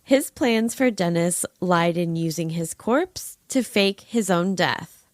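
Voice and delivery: ordinary talk, nothing marked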